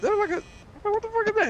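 very high, incredulous voice; retold shock. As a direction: act dumbfounded, voice cracking upward. Retells his shock, pointing it out.

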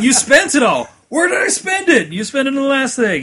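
high, shouted mock squabble; imitated exasperated shouting argument; Deadpool. Acting out Deadpool voices arguing over budget.

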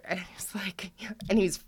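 whispered aside; hushed gossip. Real, not acted. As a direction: lean in and whisper it.

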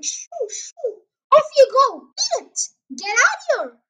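very high, loud shooing calls; shouting. A narrator's reading. Sergeant shoos birds round the filling station, shouting. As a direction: chase the birds off with sharp bursts. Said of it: "waving his hand at the peasants and shouting".

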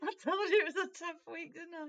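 high, loud, fast laughing protest. Real, not acted. Host defending a gloomy search to co-host, laughing protest.

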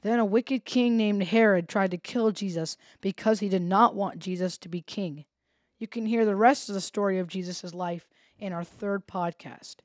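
usual speaking voice

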